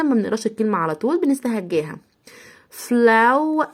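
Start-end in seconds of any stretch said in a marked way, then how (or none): 2.7-3.6 slow, spelled-out FLAW for "فلاو"; teacher sounding out a word; sounded-out flow. Shown as a demonstration. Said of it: "نطقناهم آو".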